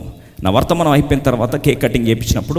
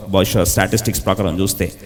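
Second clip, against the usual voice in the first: usual speaking voice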